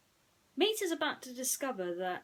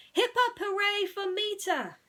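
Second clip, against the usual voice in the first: high, loud cheer; 12 semitones higher, 8 dB louder than usual. A narrator's reading. Townsfolk cheering for Mater, loud high cheer. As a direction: cheer like a whole town.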